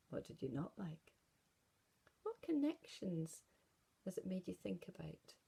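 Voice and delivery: usual speaking voice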